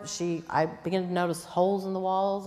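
usual speaking voice

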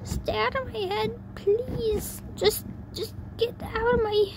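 high-pitched desperate plea; tearful pleading. Acted. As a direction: beg through tears in a high voice. Acted sobbing character begging voices to leave.

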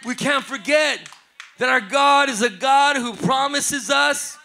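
loud, high preacher's call; full-throated altar call; preaching at full force. Real, not acted. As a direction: rouse the church to shout.

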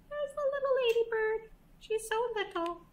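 high, soft cooing. Real, not acted. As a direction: coo over something tiny. Pointing out tiny ladybird to child.

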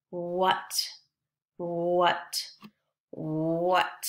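"what" with a held oo-like start; stretched wh sound. Shown as a demonstration. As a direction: lengthen the "wh" of "what", end short. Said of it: "They all have the, the woo sound".

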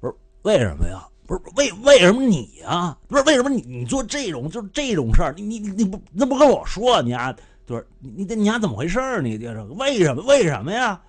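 put-on angry Beijing-dialect telling-off of an old partner; Beijing dialect rant. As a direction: yell it like a Beijing buddy who's fed up. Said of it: "用北京话来说".

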